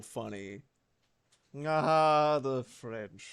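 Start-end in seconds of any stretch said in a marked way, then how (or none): 1.7-3.2 put-on lightly reprised pompous drunk slur; drunk Orson Welles. Streamer repeats the outtake for fun, drunk impression. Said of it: "make fun of Drunk Orson Welles".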